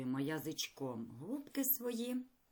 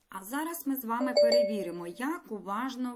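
quick, loud, bright quiz intro; upbeat announcement; 4 semitones higher, 7 dB louder than usual. Acted. Teacher to pupils, kicking off the quiz, loud and fast. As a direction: announce the quiz like a game show.

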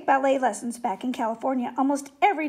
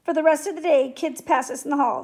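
usual speaking voice

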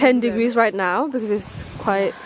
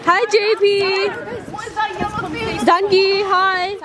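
high bright call across; 9 semitones higher than usual. Real, not acted. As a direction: call a greeting across the water. Calling out to friends.

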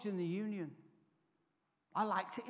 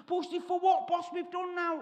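raised voice, higher, feigning innocence; mock innocent prisoners; 7 semitones higher, 5 dB louder than usual. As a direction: play dumb to the officer, pitched up. Prisoners answering officer.

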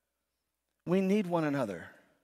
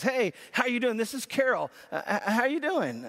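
higher, bright phone hello to a friend; cheery friend on the phone; 6 semitones higher than usual. Acted. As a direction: sing out a chipper greeting, pitched up.

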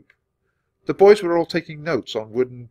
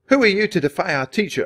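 higher, louder indignant yell; angry shout; 10 semitones higher, 7 dB louder than usual. A narrator's reading. Boy shouting at Annie for defying the teacher, angry shout. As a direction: shout him down for the insult. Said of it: "a boy shouted".

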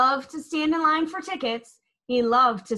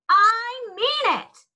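slow high loud warning; mean every word of it; boy threatening the tornado; 11 semitones higher, 4 dB louder, slower than usual. A narrator's reading. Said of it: "he threatened".

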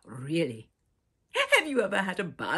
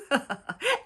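giggle; 7 semitones higher, 6 dB louder than usual. Acted. Laughs after peekaboo.

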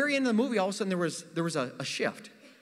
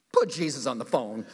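a lower, laughing order; demanding girlfriend; 8 semitones lower than usual. Retold or imitated. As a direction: say it like a suspicious girlfriend. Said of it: "She's like".

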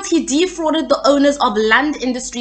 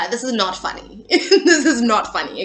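trying to regain composure through giggles; suppressed laughter; 2 semitones lower than usual. Real, not acted. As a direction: fight the laughter and fail.

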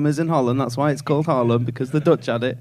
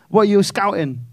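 usual speaking voice

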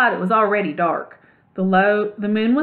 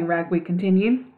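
ordinary delivery

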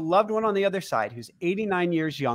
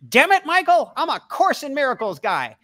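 put-on gruff old friend; 9 semitones higher, 6 dB louder than usual. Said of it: "And he goes".

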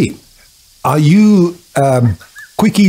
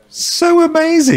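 mock amazement. Sarcastic reply to a boring coworker.

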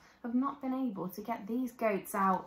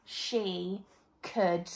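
usual speaking voice

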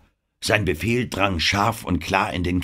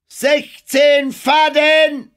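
very high, loud depth call; 9 dB louder, much slower than usual. A narrator's reading. Lookout calls the depth from the bow, high shout. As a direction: sing out the sounding at a shout. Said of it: "rief die Stimme vom Bug".

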